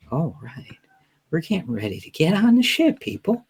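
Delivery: hushed, near-whispered vlogger voice; quiet YouTuber voice. Retold or imitated. Mocking the travel vlogger to podcast hosts, hushed whisper. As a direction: imitate the soft-spoken travel YouTuber. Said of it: "he talks like this".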